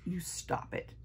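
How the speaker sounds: hushed, breathy aside; whispered scolding. Real, not acted. Shushing someone off-camera mid-review, whispered scolding. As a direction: scold under your breath.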